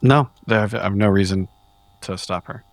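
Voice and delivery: normal talking voice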